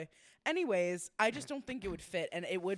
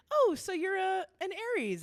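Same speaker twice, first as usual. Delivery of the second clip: retold perky reaction; 11 semitones higher, 6 dB louder than usual. Retold chirpy remark to magician.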